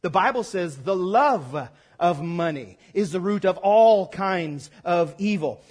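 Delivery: ordinary talk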